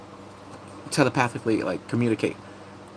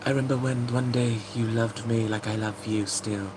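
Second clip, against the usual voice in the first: soft romantic quote; 5 dB quieter than usual. Retold or imitated. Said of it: "He's like".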